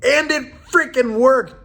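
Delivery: high, loud, slow exclamation; gleeful, drawn-out emphasis; triumphant. Real, not acted. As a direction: punch it out in amazed triumph. Triumphant punchline of handstand story.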